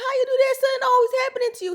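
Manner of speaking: mock the husband's scolding. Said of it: "He's like".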